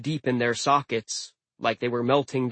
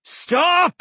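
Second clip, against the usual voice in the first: booming shout, much higher; roaring; 6 dB louder than usual. Acted. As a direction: roar the command. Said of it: "a loud voice roared".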